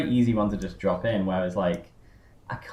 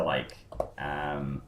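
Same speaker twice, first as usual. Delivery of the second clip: ordinary talk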